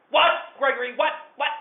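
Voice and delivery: demanding mother calling out; alarmed mother. Retold or imitated. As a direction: mom shouting to know what's wrong.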